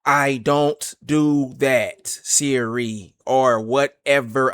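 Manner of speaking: word by word, every consonant carefully sounded; painstakingly enunciated, very slow dictation; very slow, proper. Shown as a demonstration. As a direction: speak slowly and properly for a voice assistant. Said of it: "I have to speak like this".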